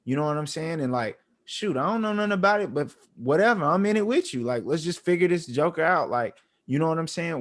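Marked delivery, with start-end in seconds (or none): 1.4-6.1 high, eager pep talk; excited dad. Retold or imitated. Dad to son, excited and high. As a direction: hype the kid up, all in. Dad cheering son's new hobby.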